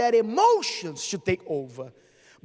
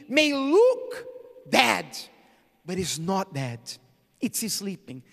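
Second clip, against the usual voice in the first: ordinary talk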